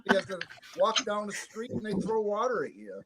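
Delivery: faint, far off the mic; down a well. Real, not acted. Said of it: "You sound like you're falling down a well".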